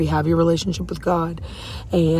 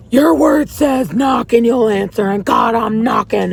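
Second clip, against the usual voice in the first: retold shout to God; 4 semitones higher, 4 dB louder than usual. Yelling at God from the car, desperate shout. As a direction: shout the plea up at heaven. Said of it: "I yelled, like, I screamed".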